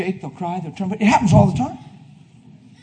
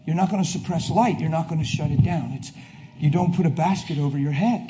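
ordinary talk

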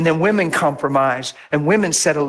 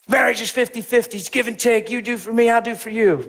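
a light sing-song mock of the experts, higher; mock psychologist; 7 semitones higher than usual. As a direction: parrot the textbook line mockingly. Mocking the psychologists' view of marriage.

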